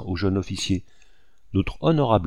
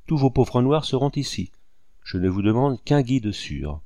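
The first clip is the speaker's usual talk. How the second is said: ordinary delivery, nothing marked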